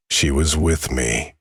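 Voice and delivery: plain reading voice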